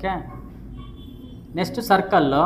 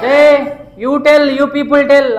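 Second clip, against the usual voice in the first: stretched, higher, louder summons; drawn-out loud demand for an answer; loud call-out; 5 semitones higher, 7 dB louder, much slower than usual. Real, not acted. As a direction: call the back row to answer. Calling on students.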